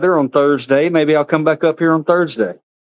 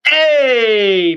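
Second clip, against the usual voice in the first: calling hey; 7 semitones higher than usual. Real, not acted. Calling to the pig.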